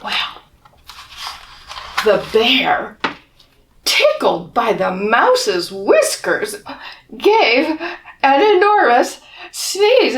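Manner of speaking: put-on lively read-aloud for children; storybook reading. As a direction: tell it like a bedtime picture book. Reading picture book to children.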